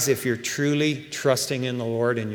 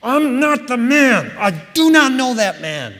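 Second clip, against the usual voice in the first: higher, louder, heated denial; angry denial; 8 semitones higher, 5 dB louder than usual. Retold or imitated. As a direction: snap back like a cornered man. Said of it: "he was mad".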